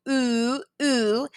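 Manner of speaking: two long, held OO vowels; isolated OO as in moon, drawn out; oo sound demo. Shown as a demonstration. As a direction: sound out oo, twice, slowly. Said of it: "has that oo sound".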